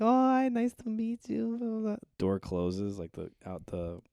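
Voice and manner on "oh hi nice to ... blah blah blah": high mock cashier. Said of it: "She's like".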